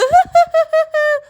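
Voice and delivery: high, loud cackle; evil witch cackle. Acted. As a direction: laugh in triumph like a witch. Witch gloats. (चुड़ैल की ऊँची, ज़ोरदार हँसी)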